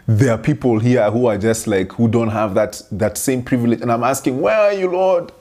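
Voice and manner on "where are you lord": pleading cry, much higher; mock desperate plea. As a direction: replay the plea as if begging God again. Retelling her own desperate prayer.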